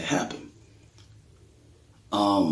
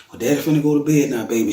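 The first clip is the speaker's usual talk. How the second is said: playing it off; 6 semitones higher, 3 dB louder than usual. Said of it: "I'm playing it off".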